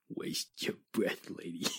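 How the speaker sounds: whispered aside. Acted. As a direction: mutter it so she can't make it out. Muttered aside, she mishears it.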